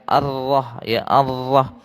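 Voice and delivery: ordinary talk, nothing marked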